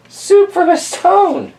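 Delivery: put-on high, slow, amazed old lady; squeaky old lady. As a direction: marvel like a tiny old woman. Old lady marvels in story.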